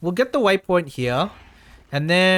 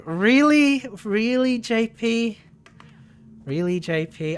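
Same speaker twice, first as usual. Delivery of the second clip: mock disbelief; 5 semitones higher than usual. Mock disbelief at a chat post.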